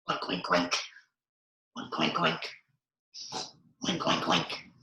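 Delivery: quick runs of imitation oinks; pig oinking. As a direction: oink like a pig, in threes. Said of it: "make a sound like a pig".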